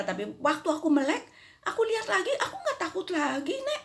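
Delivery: put-on high, laughing little girl; high laughing child's voice. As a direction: chirp it like an excited child. Child continues her story.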